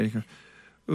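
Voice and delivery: normal talking voice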